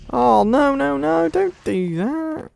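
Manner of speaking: very high, drawn-out wail; panicked pleading. Real, not acted. Player begging the enemy to stop, panicked wail. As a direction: plead in a high squeal, stretching the end.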